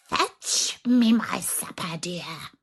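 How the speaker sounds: slow, half-whispered witch threat; menacing witch. Acted. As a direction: hiss the terms like a wicked hag. Witch voice held.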